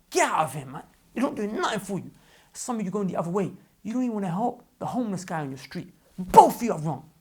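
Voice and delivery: exasperated telling-off; heated rebuke with loud bursts; annoyed. Real, not acted. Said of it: "I'm getting annoyed".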